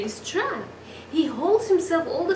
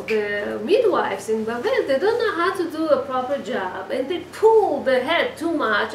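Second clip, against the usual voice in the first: normal talking voice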